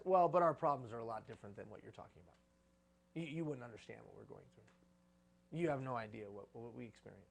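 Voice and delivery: a hint of a whiny excuse-maker; mock excuse. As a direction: play the defensive complainer, lightly. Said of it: "Excuses, such as".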